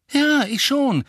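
quick, high, loud answer; at once. A narrator's reading. Harry defending Sirius to Hermine, instantly and loud. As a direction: fire back immediately. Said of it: "sagte Harry sofort".

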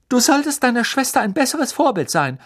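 high, sharp scolding mother's snap; mother scolding the twins; hissing at him. A narrator's reading. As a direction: tell off the twins with bite. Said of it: "fauchte Misses Weasley".

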